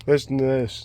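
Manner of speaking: usual speaking voice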